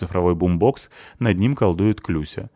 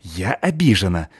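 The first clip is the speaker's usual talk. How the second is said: hissing; 3 dB louder than usual. A narrator's reading. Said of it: "зашипела она мне в лицо".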